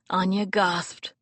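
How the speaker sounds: ordinary delivery, nothing marked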